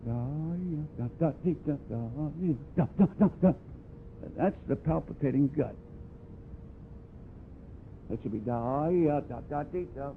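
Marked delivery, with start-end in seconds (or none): 0.1-3.5 jerky da da with gut pulses; choppy pulsing syllables. Shown as a demonstration. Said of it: "We have them do this". 8.1-10.1 softer, connected da ya da; smooth even syllables. Shown as a demonstration. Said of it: "That's even blowing".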